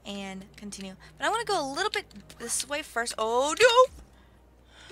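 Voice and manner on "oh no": sudden loud, high yelp; alarmed cry. Real, not acted. Gamer hit by a sudden mishap, alarmed cry.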